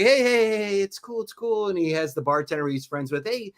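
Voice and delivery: lightly voiced friend talking you down; mild soothing mock friend. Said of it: "my friend was like".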